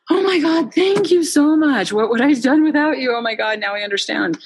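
high, gushing thanks from grateful seniors; imitating an overjoyed senior, voice soaring. Founder mimics thrilled seniors, high and gushing.

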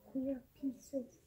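usual speaking voice

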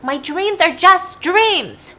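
high, loud, drawn-out cry; through sobs. Acted. Daughter made to repeat it by dad, acted sobbing cry. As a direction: imitate a distressed girl forced to say it. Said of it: "even through her sobs".

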